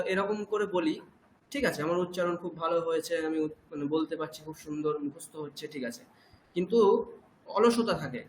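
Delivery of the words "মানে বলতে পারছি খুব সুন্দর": ordinary delivery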